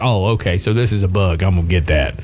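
low, musing flytrap voice; lightly voiced flytrap. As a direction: lightly play a plant thinking it over, low. Voices flytrap deciding to close.

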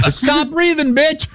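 very high squeaky voice, "bitch" fully out; funny voice. Acted. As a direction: redo the joke in a silly high pitch, landing "bitch". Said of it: "That's a funny voice".

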